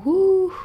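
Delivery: high, loud drawn-out "whoo"; whoop. Acted. Speaker acting out catching herself lost in her head, high whoop. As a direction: let out a sudden high whoo. Acting out sudden self-realization.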